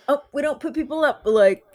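high, fast breezy host voice; tossing off a careless offer; mock showrunner. Showrunner quoted offering couch.

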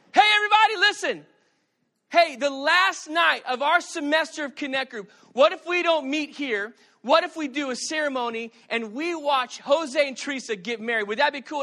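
loud, high announcement to a crowd; rally the room with big energy; addressing the group. Retold or imitated. Pastor announcing a surprise wedding to the group, loud and excited.

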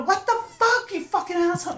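very high, squealed mock outburst; mock angry yell. Mom jokes about how she scolds her kids, high mock yell. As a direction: jokingly pretend to lose it at the kids. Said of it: "No, I usually go".

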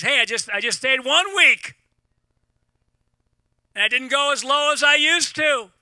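high, loud, triumphant boast; excited celebration. Acted. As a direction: cheer your own small victory at full voice. Acts out celebrating imperfect progress.

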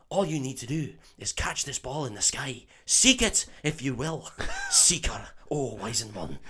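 hammed-up mystical Scottish accent; Oliver Wood. Keeper teaching Harry to seek the Snitch, Scottish accent. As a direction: play a wise old Scots mentor. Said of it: "for me to do a Scottish accent".

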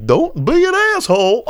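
high, loud goofy cartoon voice; hammed-up Goofy talking to Scrooge; Goofy scolding Scrooge in a high cartoon voice; Goofy impression.

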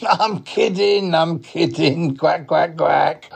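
high, stretched-out duck voice; high duck with playful quacks; joking duck. Acted. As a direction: tease like a cartoon duck, slowly. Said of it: "he is a duck".

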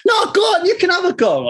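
high-pitched imitation of an urging wife; hammed-up wife, voice shooting up; insistent wife. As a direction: egg him on in a put-on high voice. Wife eagerly urging him on.

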